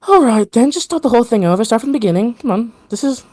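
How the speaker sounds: high, exasperated character voice; arguing woman. Acted. First of three women ordering the vision restarted, arguing. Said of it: "until the first one goes".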